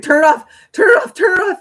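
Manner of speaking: high, loud, panicked imitation of her own plea; a hammed-up distraught cry; frantic retold plea. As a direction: beg him to shut off the tap. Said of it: "I'd be like".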